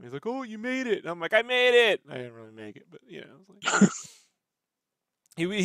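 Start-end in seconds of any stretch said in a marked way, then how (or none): 0.3-1.0 delighted, high-pitched mock congratulation; Pat Duffy. Shop owner greeting artist at convention booth, delighted imitation. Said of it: "he was really happy to see that". 1.3-2.0 high, loud echoed cheer; mock triumph. Artist answering impressed friend at booth, mock-triumphant cheer. Acted happy reply to Pat.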